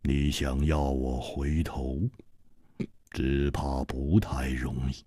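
低沉冰冷的反派嗓音，慢慢吐字; 压低声音，冷冰冰地威胁; 冷冷的黑袍客. A narrator's reading. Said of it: "冷冷地说". (黑袍客对楚留香冷冷威胁，低沉阴冷)

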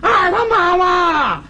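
high, slow boastful call down the alley; drawn-out holler. Acted. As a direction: stretch every word out for the neighbours. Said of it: "到家一进胡同就这嗓子".

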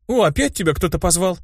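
perking up. A narrator's reading. Girl noticing someone called her companion, perked up. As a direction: perk up with a question. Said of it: "встрепенулась Улаирк".

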